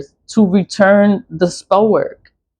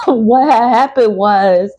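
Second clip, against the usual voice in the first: mock gossip; 6 semitones higher than usual.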